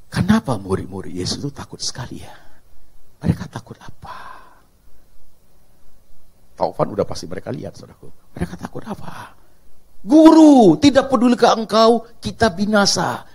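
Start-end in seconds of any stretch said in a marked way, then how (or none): 0.1-4.4 hushed whispered question. Real, not acted. Preacher whispering a question to the congregation. 10.0-13.2 loud high Guru, then pleading; alarmed plea. Acted. Disciples crying to their master, alarmed. Disciples cry to Jesus again.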